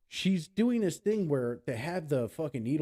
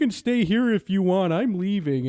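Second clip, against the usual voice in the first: high, loud cartoon voice; Simpsons impression; 9 semitones higher, 8 dB louder than usual. Brain quitting on its owner, Simpsons voice. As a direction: announce it like an exasperated cartoon character. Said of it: "it was like The Simpsons".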